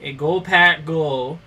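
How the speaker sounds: put-on a light Wisconsin accent; Midwestern accent. Best American impression, a Midwestern Packers fan. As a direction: chat about the Packers like a Wisconsin local. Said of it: "my best American impression is".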